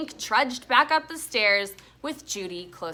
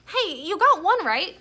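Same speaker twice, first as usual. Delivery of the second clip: cheering up; 8 semitones higher, 6 dB louder than usual. A narrator's reading. Judy cheering Stink up.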